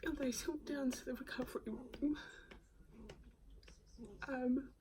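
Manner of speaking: high, tearful and close to crying; voice breaking. Real, not acted. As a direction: fight back tears while speaking. Tearful retelling after near death.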